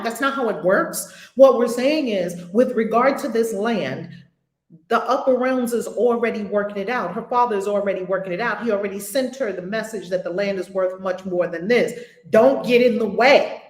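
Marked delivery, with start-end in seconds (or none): none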